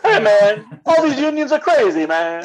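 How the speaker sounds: high, loud Eddie Murphy voice; Eddie Murphy impression.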